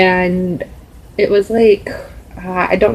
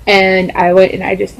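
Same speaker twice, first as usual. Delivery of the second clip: ordinary talk, nothing marked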